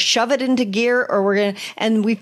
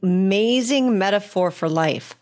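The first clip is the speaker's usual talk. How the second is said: ordinary talk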